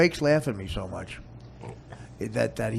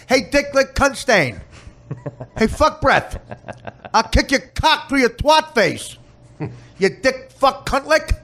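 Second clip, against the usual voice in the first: higher hammed-up cop insults; comedy cop; 14 semitones higher, 11 dB louder than usual. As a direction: rattle off absurd cop abuse, over the top. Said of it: "every comedy".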